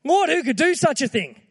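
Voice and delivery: high, loud, imitating Peter's eager protest. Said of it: "he was like".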